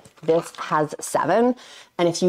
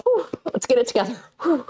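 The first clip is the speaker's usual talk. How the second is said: high-pitched, quick self-talk after near tears; emotional, regrouping; 7 semitones higher than usual. Real, not acted. Vlogger steadying themselves on camera, still emotional. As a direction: pull yourself together with a big exhale. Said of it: "I hate getting emotional on camera".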